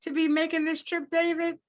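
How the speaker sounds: rising, anxious and fast from the second line on; worried concern. A narrator's reading. Grandmother worrying over her son-in-law's health, anxious and rising. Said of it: "with concern in her eyes".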